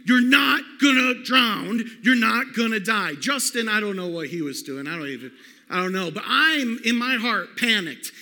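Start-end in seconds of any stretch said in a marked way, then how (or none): 0.1-3.1 loud, slow, firm shouted reassurance; dad yelling back. Retold or imitated. Father in the storm shouts to his panicking son, loud and firm. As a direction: shout him down to calm him. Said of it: "my dad's yelling".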